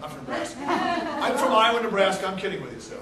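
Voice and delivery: normal talking voice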